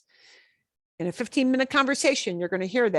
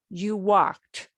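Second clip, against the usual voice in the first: normal talking voice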